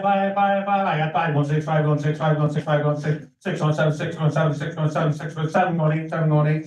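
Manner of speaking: rapid rhythmic auction chant; auctioneer. Real, not acted. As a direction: rattle off bids like an auctioneer. Auctioneer calling bids.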